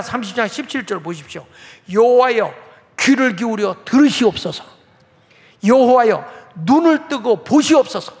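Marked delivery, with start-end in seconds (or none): 1.9-8.1 loud, insistent calls to the Lord; pleading prayer. Acted. Hezekiah spreading the letter before God, pleading prayer. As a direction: cry out each invocation, pleading to be heard. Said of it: "하나님께 기도하는 거예요".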